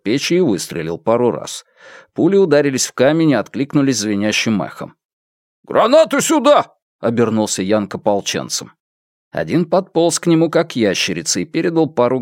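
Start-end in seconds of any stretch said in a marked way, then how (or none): 5.6-6.7 high, urgent military command; shouted order. A narrator's reading. Officer turning to his militiamen, high shouted order. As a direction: call the order sharply to your men.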